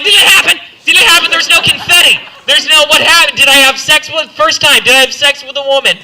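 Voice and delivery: high, loud mock panic; frantic questions, voice way up; hammed-up freak-out on the roadside; panicked. Panicked after blacking out.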